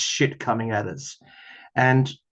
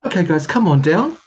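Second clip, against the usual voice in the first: higher and louder, with a rising lilt; playful call-out, pitch climbing; lively call; 6 semitones higher, 7 dB louder than usual. Real, not acted. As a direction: sing it out to the deck. Calling cards out again, livelier.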